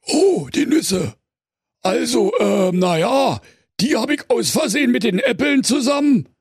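very high, hesitant bear voice; sheepish bear. A narrator's reading. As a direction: bear fumbling for excuses. Bear caught out again.

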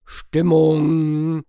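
loud party call; hearty shout. Acted. Bear rousing the foresters, loud hearty call. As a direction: rouse the room. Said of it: "erwiderte der Bär".

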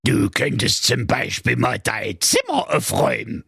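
put-on old fairy-tale witch. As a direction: lure them in like a gingerbread witch. Witch task. (alte Hexe an der Gegensprechanlage)

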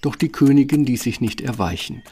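usual speaking voice

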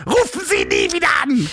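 squeaky mocking put-on voice; disguised voice. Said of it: "immer mit verstellter Stimme".

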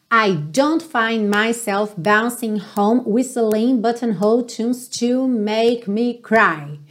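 whole line slowly, linked; slow practice read. Shown as a demonstration. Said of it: "Então, vamos praticar?". (prática lenta da frase)

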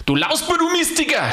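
put-on high-pitched outburst of old anger; angry yell. A narrator's reading. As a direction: scold a rascal in a high voice. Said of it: "schrie er".

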